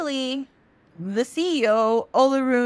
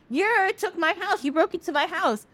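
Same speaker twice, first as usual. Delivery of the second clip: high-pitched mock outrage; indignant accusation; 9 semitones higher than usual. Moon accuses Sun before Olorun, indignant. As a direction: whine the accusation, hammed-up and high.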